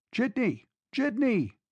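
high-pitched, urgent hail; calling out for a cab. A narrator's reading. Running man hailing a jitney in the dark, desperate call.

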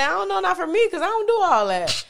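high, loud protest of innocence; mock denial. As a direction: play clueless in a high, loud voice.

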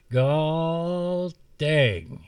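high, loud squeal through the teeth; squealed between teeth. Acted. Woodsman throws off blankets, squealing through teeth. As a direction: squeal it with the jaw clenched. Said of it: "he squealed between his teeth".

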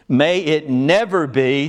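slow, weighty "May it never be," with each word leaned on; emphatic denial. Shown as a demonstration. Preacher quoting Paul's denial, slow and emphatic. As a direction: plant every word of "May it never be" slowly and firmly. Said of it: "such an emphatic".

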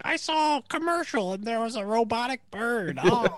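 squeaky mock voicemail caller; imitating Chris. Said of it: "And he's like".

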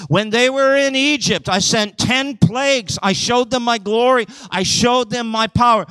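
high, insistent preaching as an aggrieved God; impassioned God. Acted. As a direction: plead the case at high pitch, pressing each phrase. Preacher voices God's exasperated plea.

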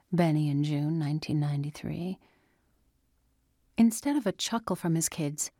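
plain reading voice

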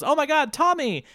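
very high, hammed-up damsel cry; mock call for help. Kimberly mocked calling Tommy to save her, very high mock cry. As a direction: shriek for Tommy like a helpless damsel.

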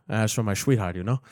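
put-on low, soft Cuban accent quote. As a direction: mumble it like Tony Montana in Cuban English. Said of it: "his accent, my friend".

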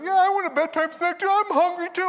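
put-on high-pitched kid whine; complaining child. Little sister echoing sibling's demand, high whiny voice. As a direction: pipe up and join the protest.

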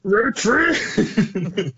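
high mock yell of retreat; mock retreat call. Joining a friend's joke, high mock yell. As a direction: echo the panicked soldier. Echoing the game quote.

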